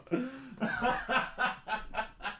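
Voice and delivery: high, quiet laugh; drawn-out high giggle. Real, not acted. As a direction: laugh softly up high in short bursts. Laughing about lost glasses.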